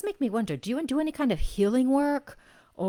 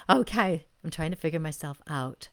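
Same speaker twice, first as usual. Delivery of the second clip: ordinary talk, nothing marked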